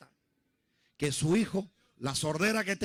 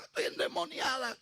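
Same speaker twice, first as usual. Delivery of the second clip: higher, softened mock cry; understated panicked cry, pitched up; imitated crying out; 6 semitones higher, 4 dB quieter than usual. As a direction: imitate a frightened girl's wail, held back. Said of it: "ella lloraba y gritaba".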